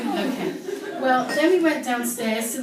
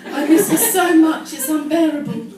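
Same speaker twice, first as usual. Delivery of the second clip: loud, high, overwrought despair; hammed-up diary heartbreak, louder and higher; melodramatic teen wail; 6 semitones higher, 9 dB louder than usual. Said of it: "started crying".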